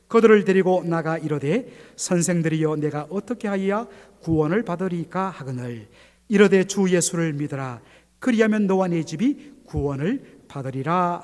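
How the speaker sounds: loud unison Bible reading; scripture reading. Acted. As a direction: read it out boldly together. Congregation reads scripture aloud.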